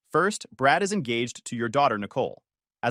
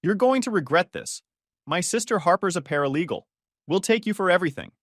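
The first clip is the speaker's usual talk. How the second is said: higher-pitched threat, mildly vicious; 6 semitones higher, 3 dB louder than usual. A narrator's reading. Wife threatening husband with legal action, vicious. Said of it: "Her voice turned vicious".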